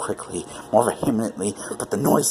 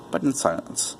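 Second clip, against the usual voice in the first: plain reading voice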